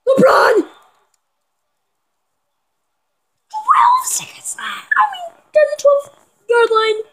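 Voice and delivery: very high, thrilled shout; excited yelling. Real, not acted. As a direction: yell as the clock runs down. Late game big play.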